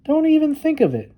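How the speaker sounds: Little Bear reassuring his friend, high and sure; Little Bear's voice. Acted. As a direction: brush the worry away in a high bear voice.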